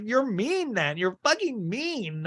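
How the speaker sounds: high, whiny accusation; whiny mock Jen. Jen calling Lisa mean, high mock whine. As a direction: whine it way up like a wounded friend. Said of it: "And Jen's like".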